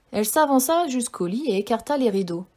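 ordinary delivery, nothing marked

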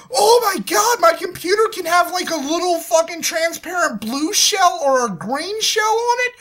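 high, loud, squealing fake delight; hammed-up consumer excitement; mock gushing buyer. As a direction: gush like a gullible shopper. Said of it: "It's like".

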